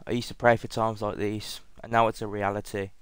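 ordinary delivery

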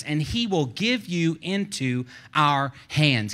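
loud, emphatic, drawn-out scripture declamation; rousing battle declaration from the pulpit; preaching at full force. Acted. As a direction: proclaim it boldly, leaning on key words. Preaches David's speech at full force.

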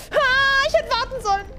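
very high loud wail of dismay; panicked cry. Real, not acted. Gamer falling after rushing, high panicked wail. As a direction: cry out as you fall. Failing a jump.

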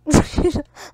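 loud, short burst of laughter; real laugh. Real, not acted. Laughing at the cactus.

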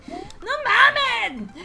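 high, loud, very slow cry; loud drawn-out exclamation; exasperated shout. Real, not acted. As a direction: wail at the game in disbelief. Game mishap exclamation.

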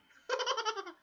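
very high, loud burst of laughter; gleeful cackle. Real, not acted. Player delighted by the victory, gleeful cackle.

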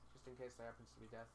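normal talking voice

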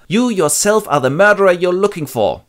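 high, loud blurted reveal; childish seer. Acted. Tiresias blurting out the truth to Oedipus, high and loud. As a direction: blurt it out like a sulking kid.

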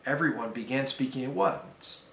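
plain reading voice

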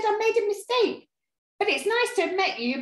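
high, hurried storybook nervousness; frightened dragon. A narrator's reading. Dragon excusing himself to the beast, frightened and high. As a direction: squeak up high and make polite excuses. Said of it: "he started to shake".

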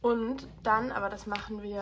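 ordinary talk

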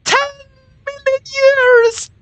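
screeched, very high-pitched cartoon shout; Lemongrab. Player mocking the captain's dungeon threat, high screeching impression. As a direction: shriek it at full pitch, stretching the words. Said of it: "that's my impression".

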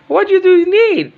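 high loud shopkeeper call. Pretend. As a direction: play the shopkeeper greeting a customer. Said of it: "She's pretending to be a customer".

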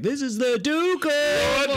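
high, slow mock royal herald; herald announcement. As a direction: announce a duke like a pompous herald. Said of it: "he'd be like".

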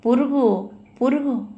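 high little exclamations of glee; lightly voiced excited child; child's delight. A narrator's reading. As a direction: spot a butterfly, pleased. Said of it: "సంబరంగా చప్పట్లు కొట్టాడు".

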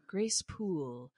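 drawn out, lost in thought; pondering murmur. Retold or imitated. Rochester pondering the name.

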